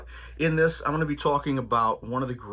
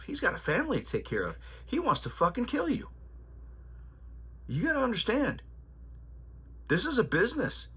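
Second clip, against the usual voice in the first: put-on much higher, stern old trainer voice; imitating his trainer; 7 semitones higher than usual. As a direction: channel the old-school trainer laying down the law. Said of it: "He goes".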